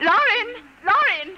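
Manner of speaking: high loud calls for her son; calling out. Acted. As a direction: call him down, urgent. Mother calling son downstairs.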